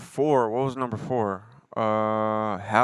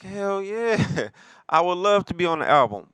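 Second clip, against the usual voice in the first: much higher, laughing mock girlfriend voice; homegirl's eager answer; 9 semitones higher than usual. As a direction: squeal it out with a laugh, hamming up her yes. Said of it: "She like".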